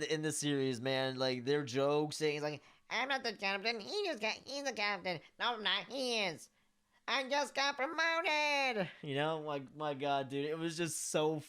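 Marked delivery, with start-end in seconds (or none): 2.9-8.9 much higher, squeaky droid voice; slowing on the last line; battle droid voice. Retold or imitated. Quoting the battle droids' jokes.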